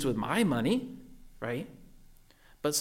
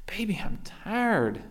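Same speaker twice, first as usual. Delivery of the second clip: tired whisper; 7 semitones higher, slower than usual. Retold or imitated. Tired dad brushing off daughter.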